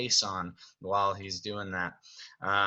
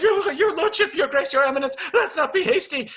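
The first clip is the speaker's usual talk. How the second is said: very high, loud stammered titles; frantically appease the angry master; Cogsworth, flustered pleading; 7 dB louder than usual. Acted. Servant trying to stop enraged Beast, panicked pleading. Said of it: "Cogsworth's like".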